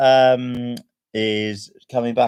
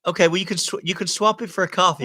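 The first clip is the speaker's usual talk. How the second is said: higher put-on American voice; American accent; 6 semitones higher than usual. Teasing the annoyed co-host, American accent. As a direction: keep up a playful American accent. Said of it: "American for the next five minutes".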